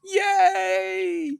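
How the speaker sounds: high, loud crowd cheer; mock cheer. Every country cheering Superman, a high, loud shout.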